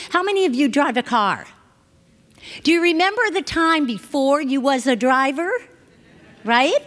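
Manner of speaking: normal talking voice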